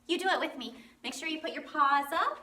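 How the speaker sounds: ordinary delivery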